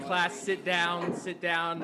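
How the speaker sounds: normal talking voice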